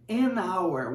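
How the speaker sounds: "an hour" linked, the N running into the vowel; slow "an hour", H silent; vowel-sound linking. Shown as a demonstration. Teacher showing the article before a vowel sound, linked "an hour". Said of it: "temos o som agora da letra O".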